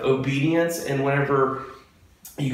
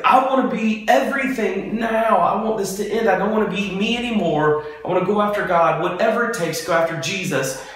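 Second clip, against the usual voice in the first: higher pleading, a touch of tears; imitating a crying plea; 6 semitones higher than usual. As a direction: mimic a broken man begging to change. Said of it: "And he's crying".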